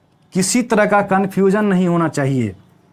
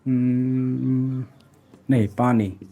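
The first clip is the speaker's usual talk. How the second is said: slow dictation; 3 semitones lower, slower than usual. Shown as a demonstration. Teacher slowly dictating the memory trick for notes. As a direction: dictate each word of the trick slowly, with long pauses. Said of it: "इसका हमने एक trick बनाया है".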